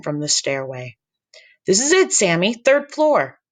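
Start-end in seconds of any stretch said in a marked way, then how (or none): 1.6-3.4 put-on high old grandmother voice; grandma voice. Grandma calls up the stairs.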